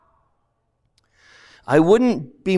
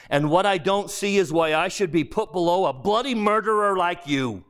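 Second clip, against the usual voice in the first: higher-pitched, insistent ghost with a grudge; aggrieved ghost; 5 semitones higher than usual. Acted. A ghost defends himself to his former employee, aggrieved. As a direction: argue like a wronged common man.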